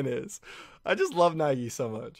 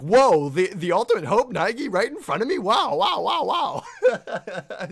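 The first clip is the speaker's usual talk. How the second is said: higher, louder gushing wow; excited mock gushing; 9 semitones higher, 8 dB louder, slower than usual. Voicing Nagito gushing over meeting Nagi, high excited wows. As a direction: gush over meeting the ultimate hope. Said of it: "and he's like".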